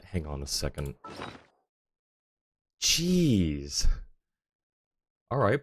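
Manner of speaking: ordinary talk, nothing marked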